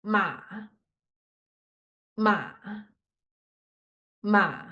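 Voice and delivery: each "Ma" falling low into the throat; third tone. Shown as a demonstration. Students struggling with third tone, "Ma" low in the throat. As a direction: keep "Ma" down low, never going up. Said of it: "make your voice stuck in your throat".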